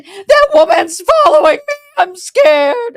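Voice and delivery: high, loud, fake-tearful complaint; mock scared whimper. Mocking the faker crying to staff, high and loud. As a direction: play the victim with a fake sob.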